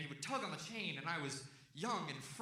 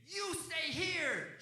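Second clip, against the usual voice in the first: high, very drawn-out mock yell; yelled command; 11 semitones higher, much slower than usual. Owner telling his dog to stay, mock yelling. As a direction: stretch out an order to a dog. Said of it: "So I yell at my dead dog".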